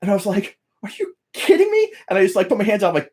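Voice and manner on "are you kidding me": high-pitched retold disbelief; incredulous, much higher than usual; amazed disbelief. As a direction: relive the amazement, pitch jumping up. Retelling his amazed reply to idol.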